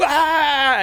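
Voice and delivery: short high mock shriek at rats; startled scream. Audience spotting rats in the aisle, mock shriek. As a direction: jump with a quick yelp. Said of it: "people go".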